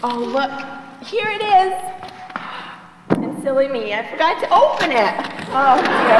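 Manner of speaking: loud, hammed-up oh dear; mock surprise. Roommate pretending she forgot the letter, hammed-up surprise. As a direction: overplay discovering the letter.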